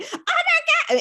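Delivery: high, loud yelp of mock panic; panicked oh my god. Author at her desk finding the book live early, high panicked yelp. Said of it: "I'm sitting here at the desk going".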